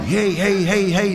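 mock holler to flag someone down; retold yelling. Lost-dog owner hails a cyclist, mock yell. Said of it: "I start screaming and yelling".

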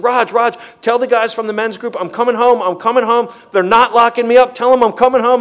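high, loud, elated cry down the phone; overjoyed shouting. Retold or imitated. Calling his sponsor after the case is dismissed, loud and overjoyed. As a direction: shout the good news like you can't contain it.

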